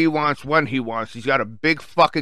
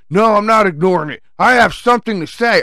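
loud, higher-pitched mock belligerence; raised, indignant imitation of an arguer; belligerent retort; 7 semitones higher, 5 dB louder than usual. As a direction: mimic a loudmouth insisting on being heard. Mimics the belligerent man arguing.

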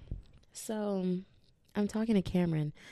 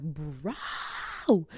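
mock scream. Podcaster reliving the rash panic, hammed-up wail. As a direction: play up the freak-out on one stretched word. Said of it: "screaming, crying, throwing up".

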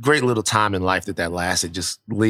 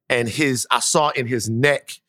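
normal talking voice

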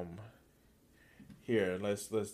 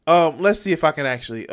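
ordinary delivery

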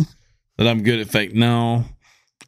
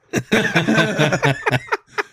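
laughing fit; 7 semitones higher than usual. Real, not acted. Breaks up over own joke.

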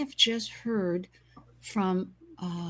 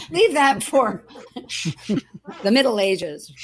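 laughing joke, louder; 7 dB louder than usual. Real, not acted. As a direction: burst out laughing mid-sentence.

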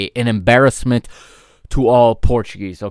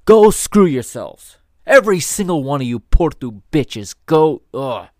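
loud, furious tirade at rival club; angry rant; 4 semitones higher, 4 dB louder than usual. Real, not acted. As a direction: let your anger boil over and shout. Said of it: "That's not the way I talk".

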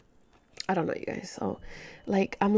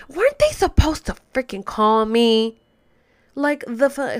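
louder, incredulous demand; exasperated complaint; 3 semitones higher, 5 dB louder than usual. Real, not acted. Caller complaining she never got called back, exasperated. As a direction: push it out loud in disbelief.

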